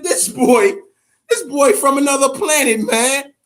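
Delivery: loud, high, awed exclaim; amazed. Retold or imitated. Fan recalling Roy Jones's knockout, loud high amazement. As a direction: hype him up like he's alien.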